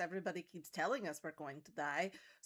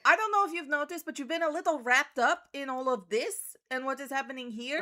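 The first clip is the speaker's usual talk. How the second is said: much louder and higher, pointed; defensive retort; 7 semitones higher, 10 dB louder than usual. Acted. Odessa rebuking her self-absorbed companion, loud and pointed. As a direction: throw it back at her, stung. Character snaps back at companion.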